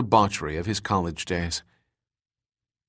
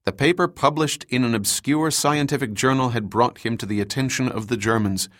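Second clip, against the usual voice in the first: ordinary delivery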